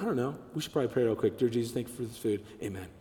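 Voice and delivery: soft, quick, throwaway grace; hurried token prayer. Retold or imitated. A congregation member mocked for a rushed grace, quick and soft. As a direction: rattle off the prayer, half-hearted.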